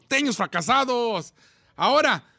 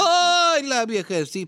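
mock tender old man; slower than usual. Co-host mocking the host's pity for the old mother, mock wail. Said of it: "¿Por qué habla así?".

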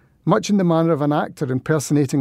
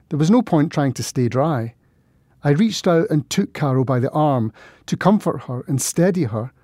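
plain reading voice

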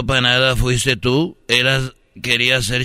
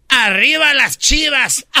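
high drawn-out shout; rallying cheer; 11 semitones higher, 4 dB louder than usual. Acted. Host cheering Chivas to the panel, rallying shout. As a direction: cheer it out like a die-hard fan.

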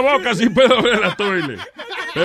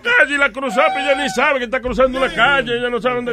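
high, insistent literal-minded objection; exasperated protest; 6 semitones higher, 3 dB louder than usual. Real, not acted. Old man picking apart the chicken joke, exasperated. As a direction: argue it as self-evident, pitched up. Viejo discute el chiste literalmente.

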